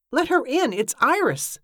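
high, insistent appeal; urgent plea. A narrator's reading. Evangeline urges Cornelia to admit Iris, high urgent plea. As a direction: urge them on with pitch lifted.

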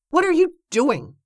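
high-pitched exclaimed question; urgent protest. A narrator's reading. Evangeline confronts Cornelia for blocking the door, high urgent protest. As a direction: protest sharply at the top of your range.

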